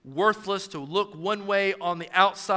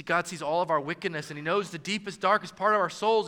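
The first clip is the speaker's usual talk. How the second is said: ordinary talk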